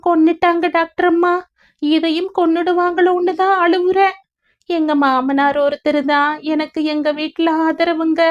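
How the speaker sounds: high, monotone plaintive lament; sorrowful young mother. A narrator's reading. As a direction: pour out your grief, held high. Grieving mother's part in story. (உயர்ந்த குரலில் சோகப் புலம்பல்)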